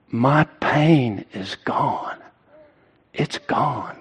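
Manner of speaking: slow, hushed imitation of tearful relief; retold tearful relief. Preacher voicing husband to wife, understated tearful relief. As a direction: let each word sink in, as if moved. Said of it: "with tears coming down".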